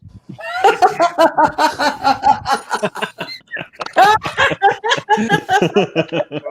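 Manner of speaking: long, high burst of laughter; big laugh. Real, not acted. Friends hearing the sheep joke, long real laughter. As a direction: crack up at a dirty joke.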